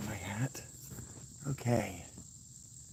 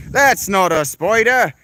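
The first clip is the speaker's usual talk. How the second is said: higher, louder, slow know-it-all Aussie; mock Australian again; 8 semitones higher, 7 dB louder, slower than usual. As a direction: ham up the commenter's scoff. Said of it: "This guy in my, my comments telling me".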